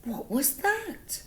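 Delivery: startled high question; surprised. Acted. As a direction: ask it as if something just jumped out. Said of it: "Missus Strawberry gasped".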